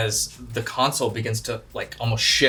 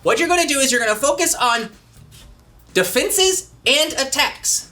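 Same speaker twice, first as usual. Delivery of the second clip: high, quick robot sidekick; Alpha's voice; 9 semitones higher than usual. Acted. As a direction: chirp it high like a little robot. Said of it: "you kinda hear in the intercom Alpha's voice".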